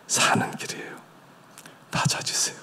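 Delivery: soft whisper. Real, not acted. Preacher whispers to the congregation. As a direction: breathe it out.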